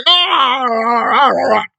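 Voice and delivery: hammed-up loud wailing ah sounds, then self-pitying; mocking her claims of trauma. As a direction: parody a dramatic victim moaning. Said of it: "It's like".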